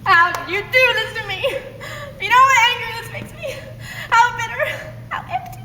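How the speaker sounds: high, fake sobbing broken by laughter; hammed-up tearful accusation; badly faked crying. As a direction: try to cry but crack up. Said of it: "it's recommended that you cry".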